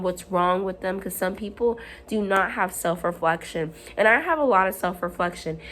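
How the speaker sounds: normal talking voice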